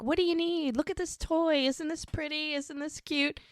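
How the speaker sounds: high-pitched coaxing, imitating a man with a baby; baby talk. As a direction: distract a fussy baby in a singsong voice. Retold man cajoling fussy baby.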